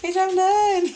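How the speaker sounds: high, loud laughing squeal; cracking up. Real, not acted. Reader cracking up at her howl, high squeal.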